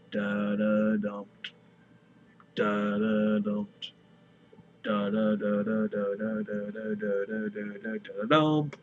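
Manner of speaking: "Da da dum" slow, then a long fast run of "da"; racing heartbeat. A narrator's reading. Narrator mimicking a heartbeat racing toward panic. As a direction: speed the heartbeat up to a frantic rattle.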